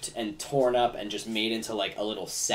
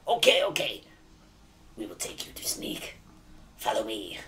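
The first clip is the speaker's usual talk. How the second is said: obliging goblin. Acted. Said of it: "the other one is like".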